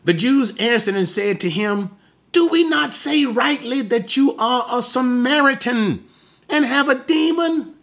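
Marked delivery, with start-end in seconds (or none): 2.3-7.7 much higher-pitched indignant challenge; angry accusation. Acted. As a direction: throw it out as a high, incredulous accusation.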